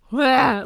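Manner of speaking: loud high mock wail of disgust; a theatrical yelp of horror at the sight. Fitter reacting to stacked bras with a mock horrified wail.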